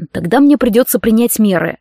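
plain reading voice

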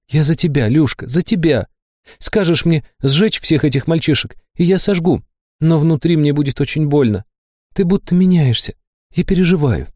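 lightly voiced girl confessing her worry; Liza, devoted and anxious. A narrator's reading. (Лиза, нежно и тревожно)

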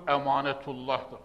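ordinary delivery

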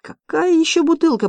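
high-pitched incredulous question; startled disbelief; astonished. A narrator's reading. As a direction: ask in sheer bafflement, pitch leaping up. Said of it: "поразился Балабуха".

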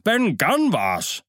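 the name drawn out slowly, high; emphatic cry. Acted. Morgan calls out who it was, slow and high. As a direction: hammer home the name. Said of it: "rief Morgan".

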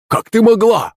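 high-pitched, aggrieved exclamation; indignant cry. A narrator's reading. Slapped servant confronts the woman, indignant high cry. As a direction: cry out in hurt disbelief. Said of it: "вскрикнул Захарыч".

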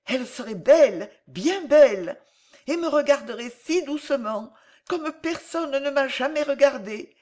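put-on high-pitched, wistful child; dreaming little boy. A narrator's reading. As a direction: daydream aloud as a small boy. Child daydreams of mother. (voix aiguë d'enfant rêveur)

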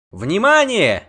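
high, loud alert; shouted call. A narrator's reading. Bard alerts the pair from above, shouted call. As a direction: holler down for attention. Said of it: "крикнул сверху Лютик".

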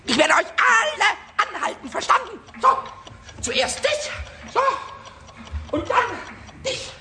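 high, loud little command; bossy shout. Acted. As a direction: order every clock to stop. Kobold commanding the clocks.

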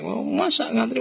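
wordy queuer outraged at being cut; indignant protest. Retold or imitated. Man cut in line protests, pitched high and indignant. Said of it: "wah kamu bunyinya panjang".

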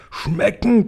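high-pitched outraged cry; narrated giant's mild shriek; indignant screech. A narrator's reading. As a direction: screech it in disgust, without full volume. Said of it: "kreischte der Blutschlucker".

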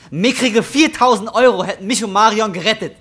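laut und hoch aufgebracht; wütender Vorwurf. Acted. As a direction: schleudere den Vorwurf mit erhobener Stimme heraus. Wütender Streit um Geld. (wütend, vorwurfsvoll)

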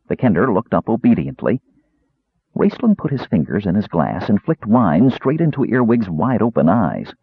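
ordinary delivery

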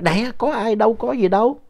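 high, lightly voiced mother brushing it off; a hint of a mother soothing grandma; mildly imitating mom's reassurance; mom reassuring. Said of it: "phủi phủi lên cái đống quần áo nói".

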